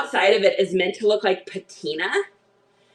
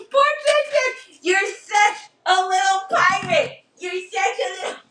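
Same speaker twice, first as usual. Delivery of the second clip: pet talk voice; 8 semitones higher than usual. Real, not acted. Cooing at her pet.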